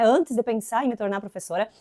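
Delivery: normal talking voice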